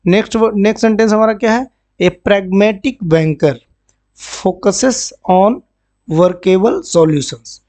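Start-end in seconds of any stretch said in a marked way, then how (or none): 2.0-7.6 very slow, word-by-word reading; slow classroom read-aloud; slow dictation. Shown as a demonstration. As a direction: spell it out slowly for the class. Teacher reads example sentence.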